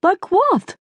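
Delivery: high, thick-tongued question; swollen tongue. Acted. Mother puzzled by Ryan's remark, swollen-tongued. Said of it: "your tongue is swollen".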